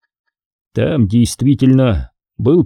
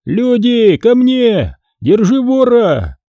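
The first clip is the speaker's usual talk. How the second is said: high, drawn-out yell; acted shout for help; 10 semitones higher, slower than usual. A narrator's reading. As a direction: holler high to summon the men. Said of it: "заорал".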